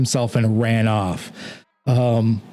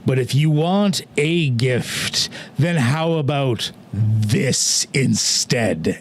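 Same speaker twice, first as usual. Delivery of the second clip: low, very slow regal menace; dark immortal granting a sinister gift; haughty vampire lord, scornful; 4 semitones lower, much slower than usual. Acted. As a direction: stretch each word with lordly contempt. Game master voices vampire figure.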